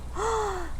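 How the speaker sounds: high sharp gasp; shocked gasp. Acted. Little bear sees his broken chair, shocked gasp. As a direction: gasp in alarm.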